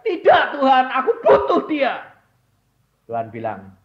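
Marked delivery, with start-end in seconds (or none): 0.1-2.1 loud, high protest to God; pleading cry. Retold or imitated. Mother crying out to God, loud and high. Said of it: "Dia bilang".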